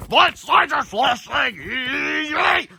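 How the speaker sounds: very slow, high, stretched cry; excited cry. Acted. Creatures lifting the villain, gleeful stretched cry. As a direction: whoop it with glee.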